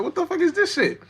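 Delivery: high, incredulous imitation of himself; baffled mock disbelief. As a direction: act out being completely lost by the movie. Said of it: "I'm like".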